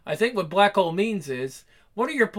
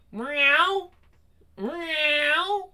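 high kitten meow; 6 semitones higher than usual. Acted. Kitten meowing up a tree.

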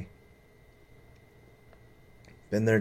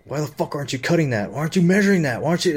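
louder, higher mock yell of a demanding boss; imitating angry foreman; 6 semitones higher, 4 dB louder, faster than usual. The foreman barking questions at him, in a mock angry yell. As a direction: throw his demands back, half-yelled and hammed. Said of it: "baffled that this guy would tell me".

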